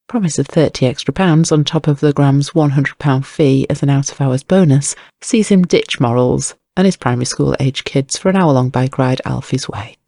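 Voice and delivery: ordinary delivery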